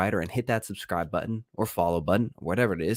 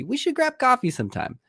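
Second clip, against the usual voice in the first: much higher sing-song salesman voice; mock vendor voice; 14 semitones higher than usual. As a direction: imitate a chirpy vendor's invite. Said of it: "maybe you've heard them invite you to coffee".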